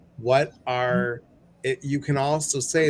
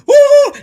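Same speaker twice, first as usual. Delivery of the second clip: high yelping woo; 16 dB louder than usual. Real, not acted. Shimmy dance, sudden yelp.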